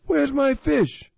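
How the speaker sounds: loud, high, drawn-out holler; shout. A narrator's reading. Big Quinn demanding his missing fish, shouting. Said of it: "he shouted".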